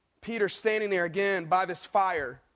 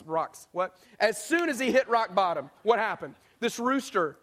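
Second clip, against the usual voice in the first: normal talking voice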